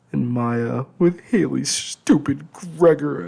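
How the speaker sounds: slow, high, loud tearful wail. Acted. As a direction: howl it out between sobs. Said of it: "I wailed".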